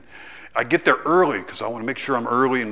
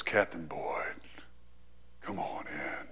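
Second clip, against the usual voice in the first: hushed invitation; 7 semitones lower, 10 dB quieter, slower than usual. Retold or imitated. The scary colonel calls him in.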